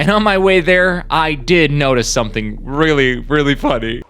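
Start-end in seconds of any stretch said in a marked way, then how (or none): none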